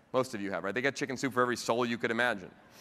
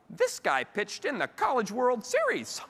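much higher, slow, laughing amazement; impressed mom; 11 semitones higher, 4 dB louder, slower than usual. Retold or imitated. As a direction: play the mother wide-eyed and impressed, missing the point. Mom impressed, not getting it.

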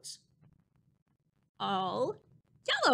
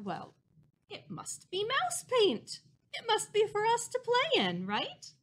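tiny squeaky mouse; 11 semitones higher than usual. Acted. Mice think aloud, story quote.

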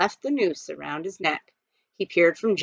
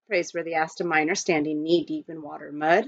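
ordinary delivery

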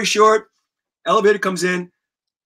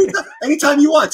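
much higher, fast eager squeak; quick high kid reply; 9 semitones higher, 4 dB louder, faster than usual. Retold or imitated. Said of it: "Tom's, like, so quick".

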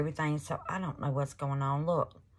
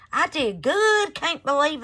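excited; 10 semitones higher, 6 dB louder than usual. Real, not acted. Surprised at her own pour.

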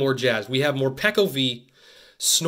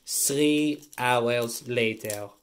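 slow, drawn-out announcer impression; SpongeBob time-card narrator; 3 semitones lower, 3 dB quieter, much slower than usual. Host covering a delay, SpongeBob-style narrator. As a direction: stretch it like a cartoon time card. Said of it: "do a please stand by SpongeBob-esque".

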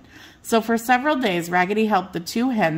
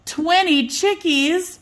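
excited counting; 10 semitones higher, slower than usual. A narrator's reading. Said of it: "she cried".

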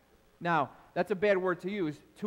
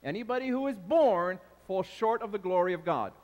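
ordinary talk, nothing marked

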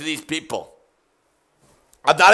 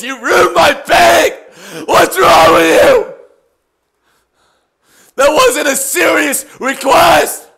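high, loud furious yelling; furious shout; 8 semitones higher, 6 dB louder than usual. Real, not acted. Streamer yelling at a troll who ruined his painting, furious shout. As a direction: yell at the troll, losing it. Ranting at noise donation.

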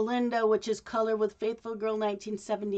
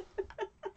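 high squeaky giggle; 11 semitones higher, 12 dB quieter than usual. Real, not acted. Laughing at own hiccups.

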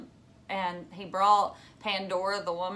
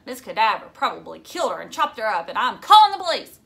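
agitated accusation; 9 dB louder, faster than usual. Acted. Phoebe arguing with her father, growing frantic. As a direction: build to a frantic outburst.

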